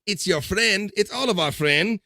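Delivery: excited greeting. Real, not acted. Greeting a viewer checking in.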